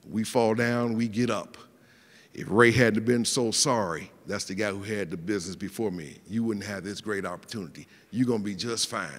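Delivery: lightly voiced old businessman, pitched well down; elderly owner quoted. Elderly owner reassuring his tenant, lightly voiced and low. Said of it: "I'm quoting, he said".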